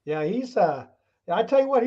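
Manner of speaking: ordinary talk, nothing marked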